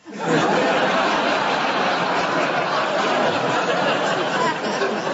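long high laugh. Real, not acted. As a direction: break into laughter. Laughing at own joke.

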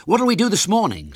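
high, loud schoolboy; eager boyish question; Ginger. A narrator's reading. Boy asks his friends for plans, high and loud. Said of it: "said Ginger".

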